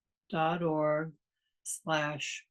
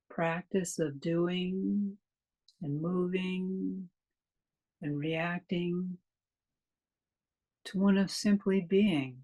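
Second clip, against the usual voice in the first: calm, unhurried meditation guide with long pauses; measured meditation delivery, leaving space after each phrase; guided meditation. Real, not acted. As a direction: lead listeners gently into stillness, phrase by phrase.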